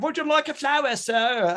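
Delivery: high, put-on bad Cockney accent; a hammed-up Cockney flower girl. Flower girl selling to a gentleman, bad Cockney accent. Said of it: "really bad Cockney accent".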